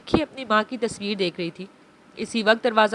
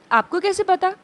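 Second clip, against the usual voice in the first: put-on a child's question, pitched higher; innocent child; 7 semitones higher, 3 dB louder than usual. Little Abi turns to Abeer, innocent and curious. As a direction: ask it like a curious little girl. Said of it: "मासूमियत से पूछा".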